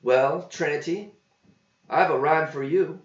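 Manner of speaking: eager to show off his rhyme; voiced John. A narrator's reading. John answers waitress.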